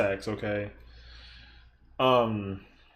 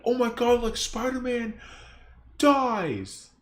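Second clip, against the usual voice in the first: high, excited mock gasp of a clickbait title; mock hyped youtuber; 10 semitones higher, slower than usual. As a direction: ham up a breathless reaction video host. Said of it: "But they literally put it in the title, like".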